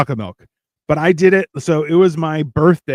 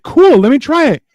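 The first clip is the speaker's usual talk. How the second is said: acted eager agreement; 12 semitones higher, 6 dB louder than usual. Acts agreeing happily to taste sauce.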